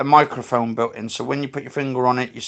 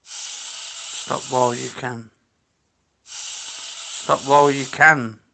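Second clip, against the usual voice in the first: reading out slowly; much slower than usual. Real, not acted. Reading out the board's message slowly.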